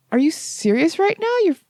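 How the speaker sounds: high-pitched mock disbelief; incredulous boyfriend. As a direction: imitate a boyfriend who can't believe it.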